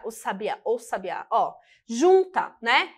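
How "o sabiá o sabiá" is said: "o sabiá" soft and low, run together; linked pronunciation. Shown as a demonstration. Teacher showing how sounds join, "o sabiá" softly linked. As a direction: glide "o" straight into "sabiá", softly. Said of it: "Quando a gente pronuncia".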